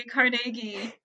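Carnegie as car-NAY-gee; Scottish pronunciation. Shown as a demonstration. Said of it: "To appease potential Scottish listeners".

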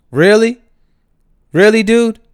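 loud, high "really?"; incredulous shout. Real, not acted. Vlogger yelling at the bus driver in disbelief. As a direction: yell your disbelief at him.

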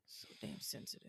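whisper. Real, not acted. Whispered teasing.

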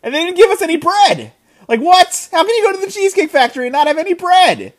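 high, incredulous outrage; exasperated high rant at the restaurant; indignant disbelief. Real, not acted. Indignant rant about no bread.

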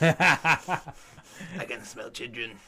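loud, slow creepy caretaker; Filch impression. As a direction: drag it out like the night watchman. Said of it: "he'd be like".

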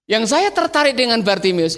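ordinary talk

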